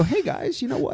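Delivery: very high, playful mock pitch; joking appeal. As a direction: pipe up high with a cheeky request. Pretend pitch to Apple.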